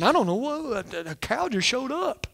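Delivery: a hushed, mock-innocent Aaron. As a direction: play dumb like Aaron dodging blame. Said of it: "I laugh at what Aaron said".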